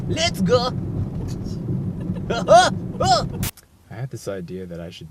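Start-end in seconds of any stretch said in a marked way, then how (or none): 0.1-0.7 Muppet voice. Shown as a demonstration. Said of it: "warming up the Muppet voice". 0.7-3.5 squeaky puppet giggle; breathy high cackle; high falsetto laugh. Shown as a demonstration. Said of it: "warming up the Muppet voice".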